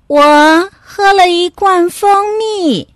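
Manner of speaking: high, slow, drawn-out admission; little dog confessing. Acted. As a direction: own up slowly in a high voice.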